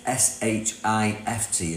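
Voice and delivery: letters S H I F T, each one spaced apart; slowly spelled out. Shown as a demonstration. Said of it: "Put an F in it".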